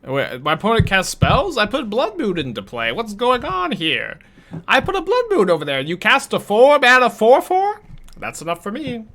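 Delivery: high, mock-outraged chat viewer voice; Twitch chatter accent. Streamer mocking a Twitch chatter complaining about the opponent, high whiny voice. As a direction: play a whiny Twitch chatter reacting to the game. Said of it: "Twitch chatter accent".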